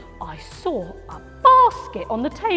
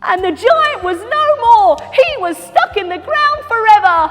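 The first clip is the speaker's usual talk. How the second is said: high, loud proclamation; big storybook climax; triumphant; 13 semitones higher, 8 dB louder than usual. Acted. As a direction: announce the giant's defeat with glee.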